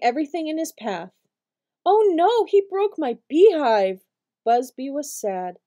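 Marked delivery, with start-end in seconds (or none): 1.8-4.0 dismayed cry. Acted. Bee sees his hive smashed.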